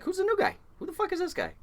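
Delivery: very high-pitched mock questioning; nosy dock guys. Dock guys asking about the new guy, high mock-incredulous. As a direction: ask it way up high, imitating onlookers.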